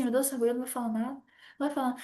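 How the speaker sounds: soft, fast fretting to herself; anxious inner rush. Retold or imitated. The warm person stuck in the silent elevator, soft fast fretting. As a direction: fret under your breath, rushing.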